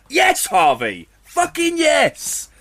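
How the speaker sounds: gleeful cheer. Real, not acted. Cheering Harvey's answer to the trolls, triumphant and slow. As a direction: cheer him on with delight.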